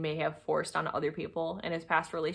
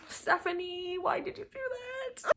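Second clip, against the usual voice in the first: higher, softer self-scolding; scolding herself; 13 semitones higher, 6 dB quieter than usual. Real, not acted. To herself about staying so long, a higher, softer scolding. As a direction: scold yourself in disbelief. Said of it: "It's just".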